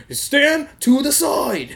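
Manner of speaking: slow, high-pitched mock command; drawn-out, hammed-up officer order; mean sheriff. Sheriff ordering prisoner aside, mock mean. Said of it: "they are a lot meaner".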